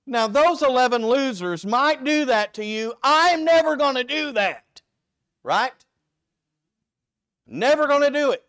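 loud, high, cocky boast; brash Peter bragging he'll never fail; boastful Peter. Acted. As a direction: shout it like a swaggering disciple. Preacher voices boastful Peter.

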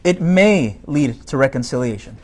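ordinary delivery

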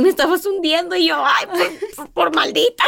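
high, strained voice of panic; retelling how her sister pulled her under; mock alarmed. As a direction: act out nearly drowning. Almost drowning memory.

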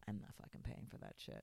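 very low, soft grumble; under-the-breath, dead-set refusal; muttered refusal. Retold or imitated. Retelling getting in the car afterwards, low muttered refusal.

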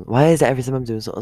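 sudden loud, high, rushed complaint; real exasperation. Real, not acted. Interrupted by a message while trying to read notes, exasperated.

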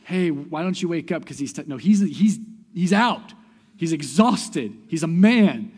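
loud, high, emphatic preaching; preaching at full force. Acted. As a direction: drive each phrase home to the congregation. Preacher raises voice insisting.

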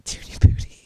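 unvoiced whisper echo. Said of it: "Both whispered it".

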